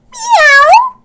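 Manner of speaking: very high, loud yowling meow; startled meow. Acted. Cat knocked over by a boot, loud high meow. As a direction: yowl as the boot hits. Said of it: "he exclaimed".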